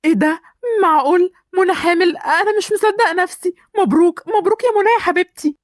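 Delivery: amazed and delighted, pitch lifted high; high, excited congratulations; joyful disbelief. Acted. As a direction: react to wonderful news you can hardly believe. Husband learns of the pregnancy.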